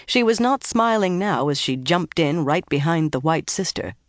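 plain reading voice

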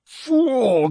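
long high groan sliding downward; drawn-out, falling groan of frustration. A narrator's reading. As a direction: groan the word in dismay. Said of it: "groaned Conan".

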